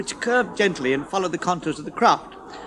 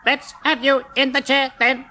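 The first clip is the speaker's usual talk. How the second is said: dentist voice; 7 semitones higher than usual. A narrator's reading.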